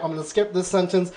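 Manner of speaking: usual speaking voice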